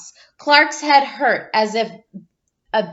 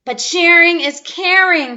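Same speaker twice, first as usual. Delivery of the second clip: protesting cry; 6 semitones higher, 5 dB louder than usual. Acted. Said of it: "cried Clark".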